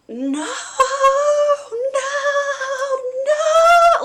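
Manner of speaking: loud, rising into a pitched wail; reliving the scream as she tells it; retold screaming no. Retelling her scream during the session, a loud high wail. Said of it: "And I was screaming".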